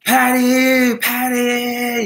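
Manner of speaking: high, loud call across an airport; calling out excitedly. Pretend. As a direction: wave her down from far off.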